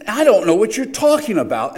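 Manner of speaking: high, loud protest; indignant denial. Acted. Preacher voices Peter's third denial to an accuser, indignant.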